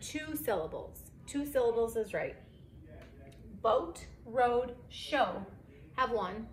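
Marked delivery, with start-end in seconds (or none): none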